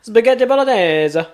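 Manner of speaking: high, slow, hammed-up Italian "Spaghetti bolognese"; mock Italian chef drawing out "Spaghetti bolognese"; fake Italian accent. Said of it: "and they go".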